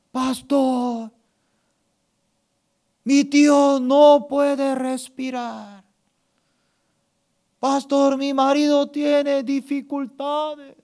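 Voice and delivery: high, drawn-out mock wail; distressed callers. Church members phone pastor for help, hammed-up distress. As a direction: plead down the line like a panicked parishioner. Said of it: "Varios hermanos me están llamando".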